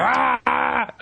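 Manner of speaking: sudden high mock yell of rage; imitating Anger's tantrum yell. Podcaster imitating Anger's fiery tantrum, high mock yell. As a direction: burst out like a cartoon temper tantrum. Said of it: "and he's like".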